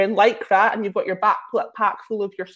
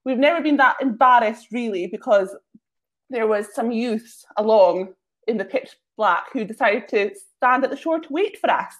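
normal talking voice

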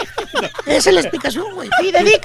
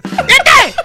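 mock shouted order; 4 semitones higher than usual. Comedian sending the drinking friend off, mock shout. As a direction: burst out with a comic command.

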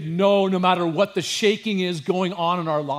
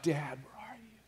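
much softer, hushed cry to a father; soft anguished plea; 14 dB quieter than usual. Acted. Jesus on the cross calling his absent father, hushed. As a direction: let it fall almost to nothing, forsaken. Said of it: "he's crying that out".

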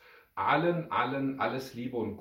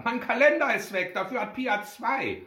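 high, loud tattling; outraged little brother; indignant child; 11 semitones higher, 5 dB louder than usual. A narrator's reading. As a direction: complain loudly to mother, pitched high. Brother accuses his sister.

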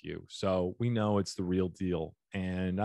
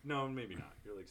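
ordinary talk

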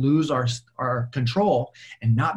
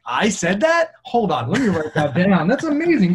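louder, climbing high in astonishment; mock amazement; 6 semitones higher, 6 dB louder than usual. Coach hears his own old advice, mock amazement. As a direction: act amazed at your own wisdom.

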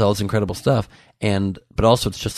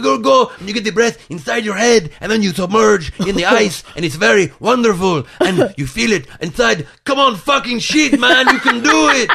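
high, loud, crazy Dutch coach voice; over-the-top Dutch accent, shouting encouragement; Dutchman impression; 13 semitones higher, 7 dB louder than usual. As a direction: hype them up like an intense Dutch trainer, pitched way up. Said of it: "So this is me doing my impression of him".

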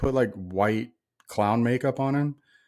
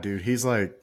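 ordinary talk, nothing marked

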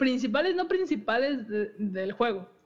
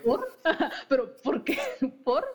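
laughing; 4 semitones higher than usual. Real, not acted. Laughing at cohost's question.

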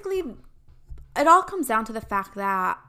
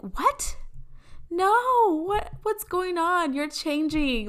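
high-pitched, dramatic dismay; shocked disbelief; 9 semitones higher, slower than usual. Retold or imitated. Mexican sister aghast at her changed brother, dramatic disbelief.

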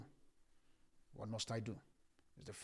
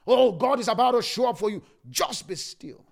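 preaching shout; 8 semitones higher, 16 dB louder than usual. Acted. Preacher cries out promise.

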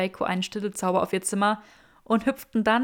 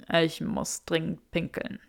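slow, softer pained grimace; slow, grimacing urgency; 4 semitones lower, 4 dB quieter than usual. A narrator's reading. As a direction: wince out the confession. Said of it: "verzog das Gesicht".

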